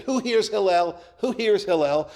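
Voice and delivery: retold heckler calling out to belittle the sage; demeaning call. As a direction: sneer it up at the window, belittling him. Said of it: "in a demeaning manner".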